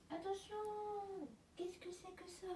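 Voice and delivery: high, soft pet-talk voice; cooing at the cat. Real, not acted. To her curious cat, a high soft cooing voice.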